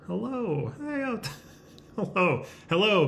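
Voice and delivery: high, drawn-out hello; playful high-pitched call to anyone there; tentative calling out. Pretend. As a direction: call into the void for listeners. Calling out to unseen viewers.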